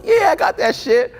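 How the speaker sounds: high and loud, overeager fake boast; mock eager. Friend bluffing to party girls, high eager squeal. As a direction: oversell it like a nervous host. Friend faking having coke.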